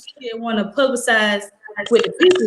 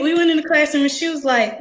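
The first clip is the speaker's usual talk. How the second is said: normal talking voice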